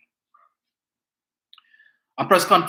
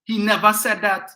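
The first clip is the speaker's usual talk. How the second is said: indignant; 6 semitones higher, 6 dB louder than usual. Real, not acted. Indignant rebuttal to false claim.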